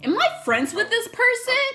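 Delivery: shocked disbelief. Retold or imitated. Shocked realization mid story.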